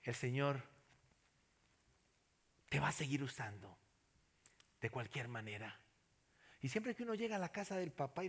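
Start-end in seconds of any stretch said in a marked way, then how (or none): 0.0-5.8 tender reassurance. Retold or imitated. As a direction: whisper comfort while stroking his head. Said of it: "le sobé su cabecita y le dije".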